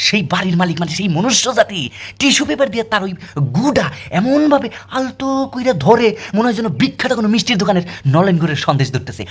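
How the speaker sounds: ordinary talk, nothing marked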